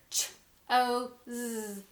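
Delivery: CHOSE split into ch, o, z; sounding out. Shown as a demonstration. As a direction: say ch, o, z as separate sounds, buzzing the z.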